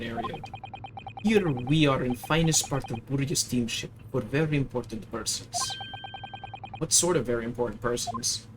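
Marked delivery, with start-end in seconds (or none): 1.2-8.5 put-on broken English in a heavy Russian accent; proud Russian crewman correcting the girl; gruff Russian sailor. Sailor to the maid, Russian accent, offended.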